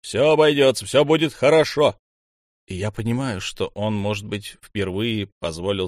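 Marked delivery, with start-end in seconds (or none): 0.0-2.0 higher, louder reassurance; loud, high call to reassure everyone; raised voice. A narrator's reading. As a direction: raise your voice over the room. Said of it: "повысив голос".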